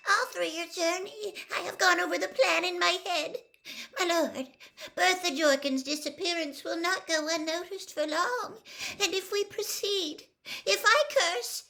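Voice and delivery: high, cringing servant voice pressing on; nervous underling laying out his worries; anxious servant. A narrator's reading. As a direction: Wormtail fretting as he pleads. Wormtail pleads with his lord.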